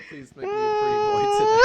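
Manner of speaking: hammed-up falsetto begging through laughter; squeaky mock prayer. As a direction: plead like a cutesy pretty boy. Mock prayer, cat pose.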